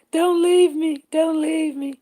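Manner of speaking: high, loud playful plea; mock pleading cry. Begging her viewers to stay, loud mock plea. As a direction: beg them not to go, hammed up.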